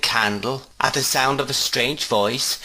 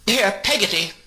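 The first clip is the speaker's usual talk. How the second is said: higher call through the doorway; 11 semitones higher than usual. A narrator's reading. Miss Betsy calling the servant from the parlour door. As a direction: summon the servant sharply. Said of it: "cried Miss Betsy".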